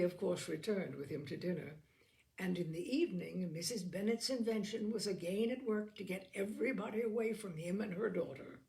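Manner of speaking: plain reading voice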